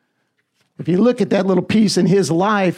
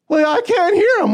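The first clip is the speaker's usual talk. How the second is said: higher, whiny mock complaint; mock doubter; 8 semitones higher than usual. Mock doubter whining to the preacher, higher pitched. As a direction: imitate a doubter who can't hear. Mock listener who can't hear God.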